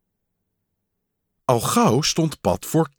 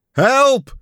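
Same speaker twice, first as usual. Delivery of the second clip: high, loud cry for help; panicked yell for help; calling out; 13 semitones higher, 6 dB louder than usual. A narrator's reading. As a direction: shout it out in alarm. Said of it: "riep Pat".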